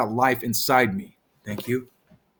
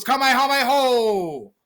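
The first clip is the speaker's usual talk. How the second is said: mock battle cry; 8 semitones higher than usual. Repeats the anime attack cry.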